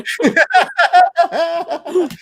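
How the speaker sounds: loud, high peals of laughter; burst of laughter. Real, not acted. Host reacts to co-host's swearing heckle, bursting out laughing. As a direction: crack up loudly and helplessly.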